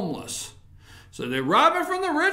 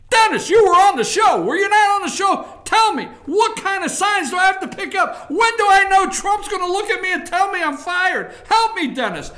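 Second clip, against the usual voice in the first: mock panicked plea; 6 semitones higher, 6 dB louder than usual. Imitating Kim pleading to Rodman.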